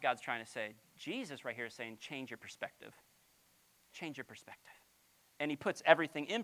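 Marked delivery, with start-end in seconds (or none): 2.0-2.9 much softer, lower voice; gentle, quiet. Shown as a demonstration. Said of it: "gentle repeated line". 3.9-4.7 soft whispered repeat. Shown as a demonstration. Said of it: "repeated as whisper".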